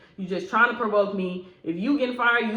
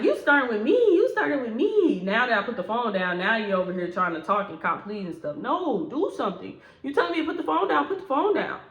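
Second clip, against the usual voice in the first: mimicking the coworker. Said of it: "She like".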